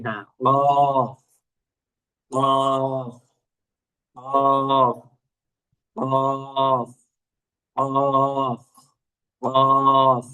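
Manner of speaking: কফ said slowly, thick and heavy from the throat, over and over; repeated thick qaf. Shown as a demonstration. Teacher drilling the heavy qaf for the class to copy. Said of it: "আমি যেভাবে বলি এভাবে বলার একটু try করি".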